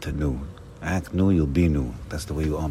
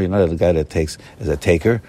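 ordinary talk, nothing marked

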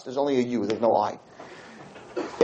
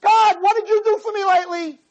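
higher, demanding whine at God; higher mock-entitled plea; demanding prayer; 11 semitones higher, 3 dB louder than usual. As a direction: toss a complaint up at God like he owes you. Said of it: "the relationship with us and Hashem is".

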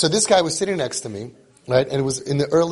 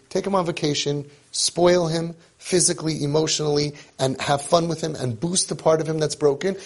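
ordinary talk

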